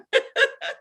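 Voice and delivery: laugh-cry. Real, not acted. Emotional laugh after Afrikaans slip.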